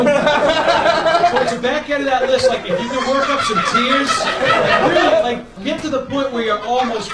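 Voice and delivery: cracking up mid-story; laughing hard. Real, not acted. Laughing while retelling.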